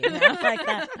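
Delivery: high, loud burst of laughter; laughing. Real, not acted. Host cracking up after telling the husband story, high and loud. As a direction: laugh it out at your own joke.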